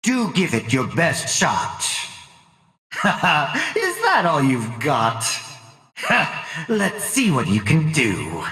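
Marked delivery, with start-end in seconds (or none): 2.9-3.7 short, scornful villain laugh; mocking chuckle. Villain unimpressed by opponent's best shot, mocking chuckle. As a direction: chuckle at a feeble attempt.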